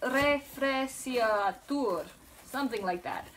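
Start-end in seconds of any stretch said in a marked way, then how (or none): 0.0-2.1 French accent. Shown as a demonstration. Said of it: "So you'd say refrigerator".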